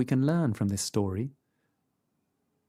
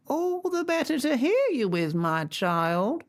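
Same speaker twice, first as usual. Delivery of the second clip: much higher croaky fake granny; croaky grandmother voice; 13 semitones higher than usual. A narrator's reading. Wolf in bed faking grandmother, high and croaky.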